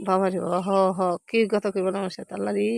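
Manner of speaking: low, soft muttering to herself; quiet aside. Real, not acted. To herself about the sulking cow, soft mutter. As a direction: mutter the complaint under your breath.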